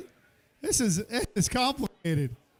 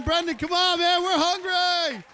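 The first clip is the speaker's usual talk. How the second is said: crowd cheer; 7 semitones higher, 3 dB louder than usual. Shown as a demonstration. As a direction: lead the crowd, show them what to yell.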